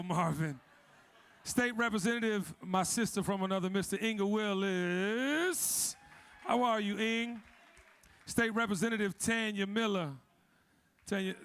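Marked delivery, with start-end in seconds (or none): none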